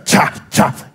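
two sharp unvoiced jabs; stabbing noises. Shown as a demonstration. Pastor imitating words thrown at a wife, stabbing. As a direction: fire off cha like knife stabs. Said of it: "They, you tell her".

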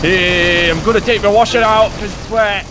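high-pitched mock battle cry; mock war cry. Mock battle cry about washing.

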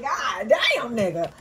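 mock shout of joy; excited shout. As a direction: blurt it out thrilled. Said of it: "I'm like".

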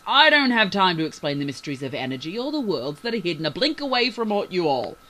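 loud, exasperated tirade; impatient. A narrator's reading. Captain refuses to explain to the crew, impatient and loud. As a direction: rant loudly, out of patience. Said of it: "The captain lost his patience".

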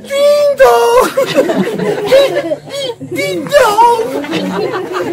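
high sung ding dong chime; station chime. Acted.

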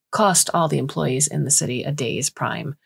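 usual speaking voice

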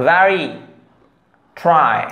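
"very, try" spaced out, each R clear; R pronounced. Shown as a demonstration. Teacher recapping correct examples, R clearly sounded. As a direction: model "very" and "try" one at a time with audible R. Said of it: "এরকম".

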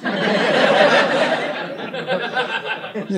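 high, breathy near-silent laugh. Retold or imitated. Imitating De Niro's silent laugh, high and breathy. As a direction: laugh with almost no noise. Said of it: "He goes".

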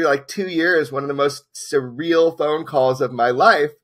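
usual speaking voice

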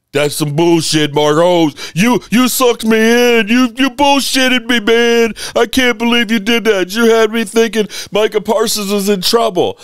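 high, loud outraged complaint; oburzony widz. Pretend. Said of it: "I know what y'all gonna say".

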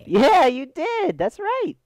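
very high, squealed taunt; mock gloating. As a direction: crow it in a loud falsetto. Crows at burning zombie.

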